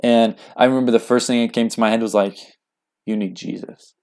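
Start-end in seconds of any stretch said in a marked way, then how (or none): 3.0-3.9 soft, earnest realization; sincere concern. Retold or imitated. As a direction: say it gently, as a quiet thought. Not in the way.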